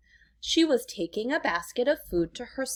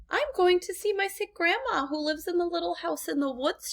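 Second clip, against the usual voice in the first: put-on higher, lighter little girl voice; 8 semitones higher than usual. A narrator's reading. Girl tells the wolf where she's going, higher voice. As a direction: play the little girl answering sweetly.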